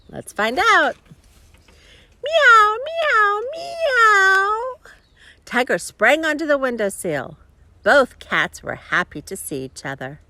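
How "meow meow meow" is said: high, loud, drawn-out meowing; cat meows. Acted. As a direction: call like a cat, rising at the end. Reader meows like cats.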